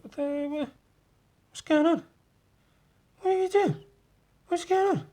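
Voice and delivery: very high, squeaky goblin panic; a tiny creature squealing in alarm; squeaky panicked goblin. Acted. Comic hero voicing a cornered goblin, high squeaky panic. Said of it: "looking up like".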